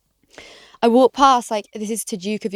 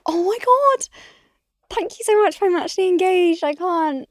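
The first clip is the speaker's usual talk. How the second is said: flustered gushing; 8 semitones higher than usual. Retold or imitated. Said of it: "And I was like".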